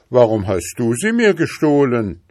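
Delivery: put-on stubborn boy standing his ground; defiant. A narrator's reading. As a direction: throw the accusation right back. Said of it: "gab der Junge trotzig zurück".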